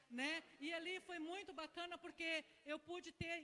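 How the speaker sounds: normal talking voice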